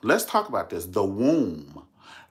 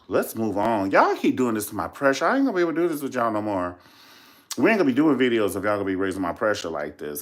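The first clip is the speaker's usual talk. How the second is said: normal talking voice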